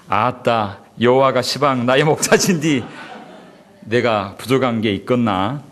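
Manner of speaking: easygoing Jeolla dialect, lightly done. Pretend. Pastor quoting Psalm 23 in Jeolla dialect for the congregation, lightly done. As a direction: drawl out a friendly Jeolla line, not heavily. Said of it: "전라도로 이렇게 이야기한다면".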